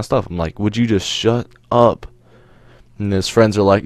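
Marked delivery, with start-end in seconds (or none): 0.6-2.1 slow, higher-pitched fed-up retort; retold exasperation. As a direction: drag out an exasperated put-down.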